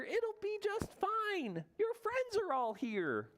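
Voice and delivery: very high, drawn-out little voice; reassuring Mouse. Acted. Mouse reassures sick Bear, high slow little voice. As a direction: comfort him in a tiny high voice, unhurried. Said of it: "He whispers in his ear".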